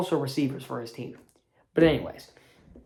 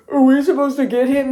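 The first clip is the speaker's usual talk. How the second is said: lightly voiced dumb defender, louder; mock confused defender; 6 semitones higher, 7 dB louder than usual. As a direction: play a clueless Steeler. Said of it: "Steelers would be looking like".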